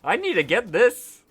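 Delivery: high, slow, dazzled squeal; excited cat voice. Retold or imitated. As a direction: gush like a cat spotting a toy. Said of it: "I'm like".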